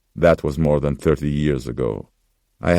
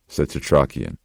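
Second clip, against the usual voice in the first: ordinary delivery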